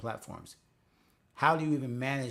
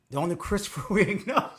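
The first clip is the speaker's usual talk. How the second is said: giggly, high and loud; reading through laughter; laughing while reading; 7 semitones higher, 7 dB louder, faster than usual. Real, not acted. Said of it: "I can't even read it with a straight face".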